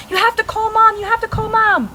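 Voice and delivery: put-on high, urgent child voice; panicked boy. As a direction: plead it in panic. Scared brother pleading.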